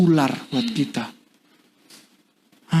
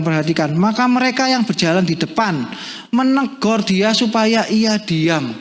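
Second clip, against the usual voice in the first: usual speaking voice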